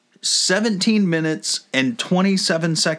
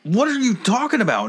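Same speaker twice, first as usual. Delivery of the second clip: much higher, incredulous question; baffled retort; 10 semitones higher, faster than usual. Retold or imitated. Confused driver answering the worker's orders, baffled. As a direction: throw it back in confused protest.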